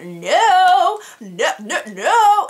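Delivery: high, loud drawn-out cry of dismay; exaggerated refusal. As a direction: playfully reject it, high and drawn out. Acted reaction to wrong shade.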